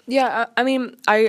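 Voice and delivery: talking with mouth full; chewing while talking. Real, not acted. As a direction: speak around a bite of food. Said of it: "Sorry, I'm chewing".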